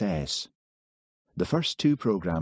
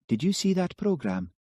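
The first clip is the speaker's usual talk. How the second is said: put-on light, higher lilting young woman; a gently voiced Highland girl; soft lilting Highland woman's voice; 12 semitones higher than usual. A narrator's reading. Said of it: "Her soft voice had a Highland lilt".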